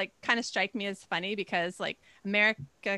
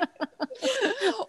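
laughing; 12 semitones higher than usual. Real, not acted. Laughing at own joke.

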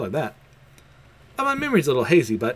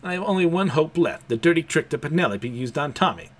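ordinary talk

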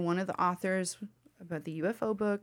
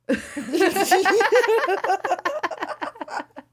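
loud laugh; 13 semitones higher, 9 dB louder than usual. Real, not acted.

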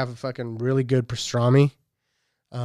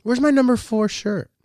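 imitating his daughter; 9 semitones higher than usual. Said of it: "she's got a little Derek Carr jersey".

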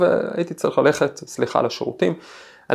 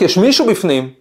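retold shout; 4 semitones higher, 3 dB louder than usual. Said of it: "והוא צועק".

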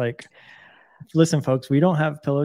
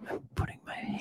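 barely-there whisper close on the mic; mock-intimate ASMR whispering; 15 dB quieter than usual. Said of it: "then you have to whisper".

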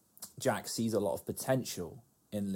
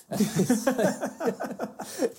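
laughing; 14 semitones higher, 5 dB louder, much slower than usual. Real, not acted. Laughing at the retold joke.

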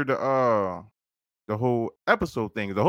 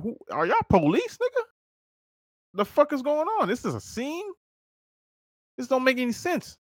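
incredulous disbelief; 13 semitones higher than usual. Retold or imitated. Retold disbelief at police scene.

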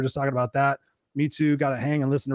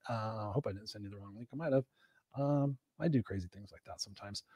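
muttered aside to self; 4 semitones lower, 12 dB quieter than usual. Real, not acted. Host muttering to himself aside.

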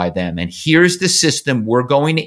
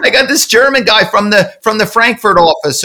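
excited employee; 8 semitones higher, 4 dB louder than usual. Retold or imitated. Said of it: "They'd be smiling".

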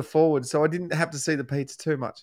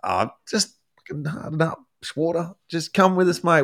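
mild mock frustration; 5 semitones higher than usual. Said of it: "getting really pissed off with him".

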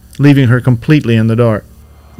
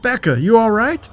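high-pitched call of concern; neighbor yelling out; 10 semitones higher than usual. A narrator's reading. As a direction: call out to check on someone, voice raised in pitch. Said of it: "as the neighbor yells out".

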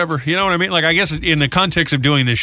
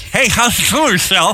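friend on the phone; 6 semitones higher than usual. Acted. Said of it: "let's see if we can reenact".

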